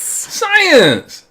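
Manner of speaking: hammed-up gleeful shout of science; excited exclamation. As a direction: announce it like a game-show reveal. Triumphant answer to how Earth's age is known.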